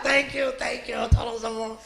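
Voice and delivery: high, softened imitation of grateful demons; soft, lifted voice giving hammed-up thanks. Shaman voices demons thanking him, grateful demon voice. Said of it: "Even the demons are like".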